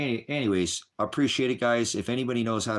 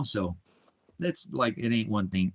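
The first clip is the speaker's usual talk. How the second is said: ordinary talk, nothing marked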